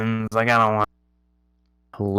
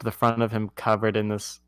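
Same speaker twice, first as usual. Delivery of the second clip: normal talking voice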